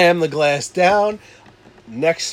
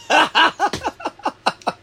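high giggle; 13 semitones higher than usual. Real, not acted. Laughing at drunk memory.

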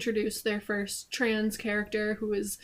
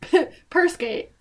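excited; 8 semitones higher, 7 dB louder than usual. Real, not acted. Coining a joke name.

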